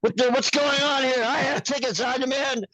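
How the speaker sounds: high, loud indignant yelling; a puppeteer yelling at security, high and loud; screaming rant. Retold or imitated. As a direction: scream it at security like an outraged customer. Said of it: "I started screaming".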